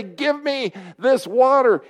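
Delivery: high, slowed, emphatic plea; imagine her demanding it, each word drawn out; eager demand. Pretend. Voices the woman's urgent demand.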